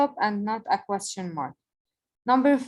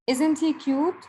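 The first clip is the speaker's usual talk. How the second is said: questioning rise; 6 semitones higher than usual. Shown as a demonstration. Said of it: "with a question mark".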